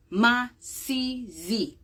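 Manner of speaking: "Masizi" drawn out slowly, each syllable ma-si-zi clear; Swahili word said slowly. Shown as a demonstration. Teacher slowly drilling "Masizi" for viewers, careful syllables. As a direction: spell out "Masizi" slowly for learners. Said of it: "Meaning soot in Swahili".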